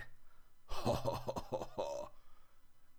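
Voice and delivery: kurzes, gehauchtes Lachen des kleinen Bären. Acted. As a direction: ein leises Kichern, fast tonlos. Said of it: "Der kleine Bär lachte". (leises, gehauchtes Lachen)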